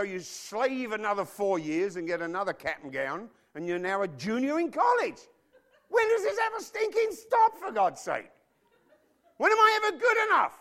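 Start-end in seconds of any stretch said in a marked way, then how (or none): 5.9-10.5 high, loud frustrated cry; exasperated outburst. Retold or imitated. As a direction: vent it like a fed-up kid. Exasperated rant of a junior.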